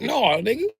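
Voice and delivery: high, loud, fast indignant rant; heated comic rant; angry rant. Acted. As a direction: tell him off hard. Ranting back at the crackhead.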